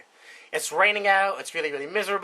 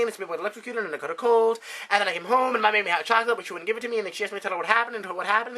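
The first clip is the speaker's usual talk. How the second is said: put-on higher, fast run-on kid's explanation; boy babbling his story to his father; nervous rush; 5 semitones higher, faster than usual. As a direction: blurt it all out in one anxious breath. Billy rushes through explanation to dad.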